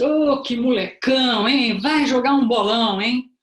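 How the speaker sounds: put-on visitor greeting baby boy. Visitor meeting a newborn boy, loud boisterous greeting. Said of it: "a entonação de voz".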